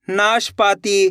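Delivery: नाशपाती slowly, as naash, paatee; two parts. Shown as a demonstration. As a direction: say नाशपाती in two slow chunks. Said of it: "दो दो करके".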